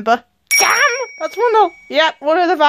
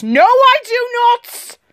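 drawn-out, high-pitched protest; emphatic denial; 7 semitones higher, slower than usual. Acted. Asked about liking James, emphatic high denial. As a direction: deny it indignantly, stretching each word.

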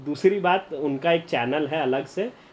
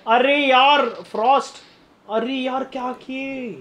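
high, slow, stretched frustration; exasperated groan; 8 semitones higher, slower than usual. Real, not acted. Streamer groaning at Frost's mistake, slow high frustration. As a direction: drag out the disappointment.

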